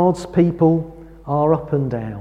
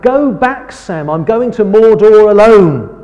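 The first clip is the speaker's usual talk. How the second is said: retold yell, higher and louder; yelling back; 5 semitones higher, 7 dB louder than usual. Frodo yells back at Sam from the boat, a retold shout. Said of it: "Frodo yells back".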